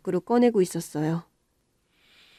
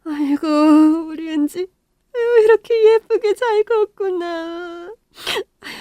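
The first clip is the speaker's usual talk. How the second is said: much higher, slow tearful praise; crying with joy; 9 semitones higher, 5 dB louder, slower than usual. Acted. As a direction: sob it out, overwhelmed with joy. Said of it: "한참을 울었습니다".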